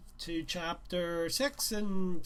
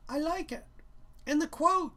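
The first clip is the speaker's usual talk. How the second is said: higher, brighter-pitched teacher; higher teacher voice, louder on the question; 13 semitones higher, 7 dB louder than usual. Acted. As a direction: voice the art teacher asking.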